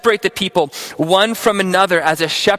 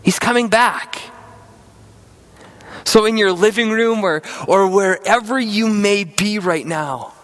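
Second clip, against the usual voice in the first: ordinary delivery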